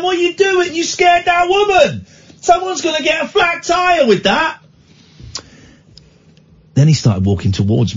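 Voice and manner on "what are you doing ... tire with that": high, indignant yell; shouting out the window. Retold or imitated. Driver telling off a man for scaring a woman, shouting. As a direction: scold a stranger from across the road. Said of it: "I started shouting out of the window".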